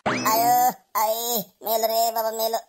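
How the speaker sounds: drawn-out moans of a beaten man; pained groaning. Acted. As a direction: groan slowly as if finished off. Old man groaning after the beating.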